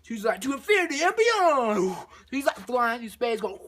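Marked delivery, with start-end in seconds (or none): 0.4-1.9 loud, high, swooping catchphrase; mock heroic cry. Astronaut flies to her rescue, mock heroic cry. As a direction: belt it out like a cartoon hero.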